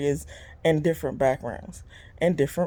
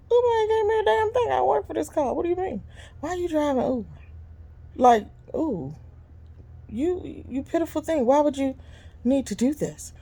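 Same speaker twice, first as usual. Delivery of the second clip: sassy retort; 11 semitones higher than usual. Retold or imitated. Her retort to the passenger.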